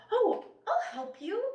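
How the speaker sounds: very high, bright offer of help; high sing-song helpful woman; eager helper voice. Retold or imitated. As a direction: chirp it like a keen volunteer. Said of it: "And she was like".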